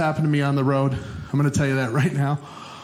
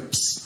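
hiss psst; 5 dB quieter than usual. Retold or imitated. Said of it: "It was like".

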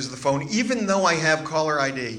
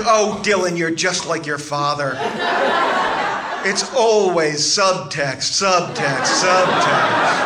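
louder, slow, weary mother's complaint; exasperated mother; 4 semitones higher, 6 dB louder, slower than usual. Retold or imitated. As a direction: hammer the word subtext, fed up.

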